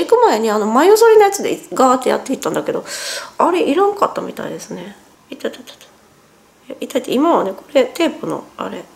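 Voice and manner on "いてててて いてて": ouch. Real, not acted. Pain while peeling tape.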